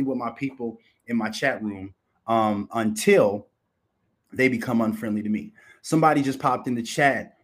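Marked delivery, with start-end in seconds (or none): none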